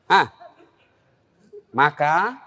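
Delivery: ordinary talk, nothing marked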